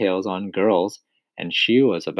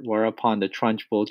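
ordinary delivery, nothing marked

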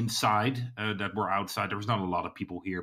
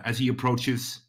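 usual speaking voice

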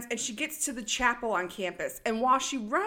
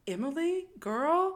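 mock worried call; 7 semitones higher, slower than usual. Mimicking Jason calling out.

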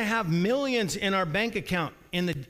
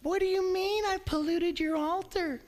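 high, whining indignant question; indignant whine; 11 semitones higher than usual. Retold or imitated. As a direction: protest like a wounded innocent. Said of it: "And you say".

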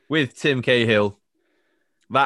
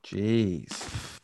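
ordinary delivery

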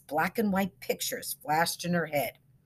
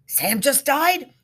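alarmed; 9 semitones higher, 7 dB louder, slower than usual. A narrator's reading. Woken friend alarmed.

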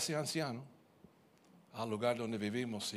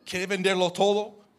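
loud, higher angry comeback; angry retort; 8 semitones higher, 9 dB louder than usual. Retold or imitated. Husband retelling how he snapped at his wife, angry. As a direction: snap back at her, indignant.

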